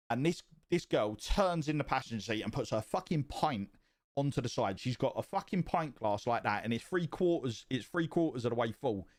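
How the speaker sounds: ordinary talk, nothing marked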